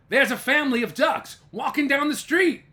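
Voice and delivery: high, loud urgent call to headquarters; policeman Michael calling in. Acted. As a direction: raise the voice, report to the station. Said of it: "He called Clancy at headquarters and said".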